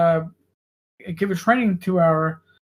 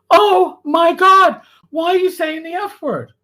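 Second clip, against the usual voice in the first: scandalized mom; 8 semitones higher, 5 dB louder than usual. Retold or imitated. Mother scolds her son for swearing, higher and louder. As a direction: ham up the horrified mom.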